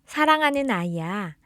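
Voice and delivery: ordinary delivery, nothing marked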